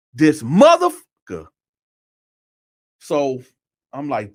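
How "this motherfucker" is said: loud, high punch on the curse; indignant outburst. Retold or imitated. Man in the shower recognising the voice, acted indignation. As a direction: re-enact outrage, blasting the curse. Angry thought repeated.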